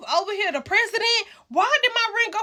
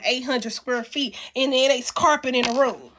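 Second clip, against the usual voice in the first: normal talking voice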